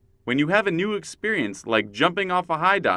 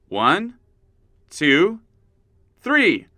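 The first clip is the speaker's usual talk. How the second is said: building count; 4 semitones higher, 5 dB louder, much slower than usual. Acted. Counting down the hop start.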